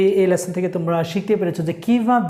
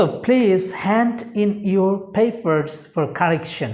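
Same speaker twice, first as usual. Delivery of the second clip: slow dictation; much slower than usual. Shown as a demonstration. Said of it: "noun form দিয়ে correction".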